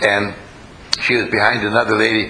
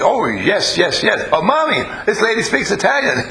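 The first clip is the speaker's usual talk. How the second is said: high, eager imitation of a small boy; high-pitched child impression with a chuckle; excited little boy; 8 semitones higher than usual. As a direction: play a delighted kid telling Mommy, breaking into laughter. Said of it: "the little boy".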